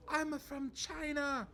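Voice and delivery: put-on high, slow Chinese-accented woman; imitating a Chinese woman's accent, drawn out; imitated Chinese accent. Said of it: "impersonations of other people's accents".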